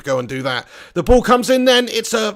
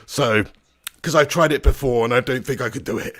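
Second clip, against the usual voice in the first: normal talking voice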